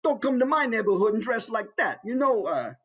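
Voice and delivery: high, pushy New York tough guy; in-your-face New Yorker. Acted. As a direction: get in their face, New York style. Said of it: "He's right there in your face".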